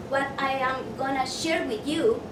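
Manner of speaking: ordinary talk, nothing marked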